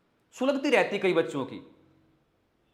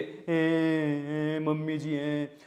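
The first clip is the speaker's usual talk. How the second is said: mock crying; 5 semitones lower, 4 dB quieter, much slower than usual. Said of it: "वो बेचारी रो रही है".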